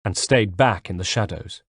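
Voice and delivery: ordinary delivery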